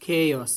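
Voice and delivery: loud "chaos" as KAY-os; emphatic corrective "chaos", KEI-os; correct pronunciation. Shown as a demonstration. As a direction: punch out "chaos" with a long ay. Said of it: "not chaos".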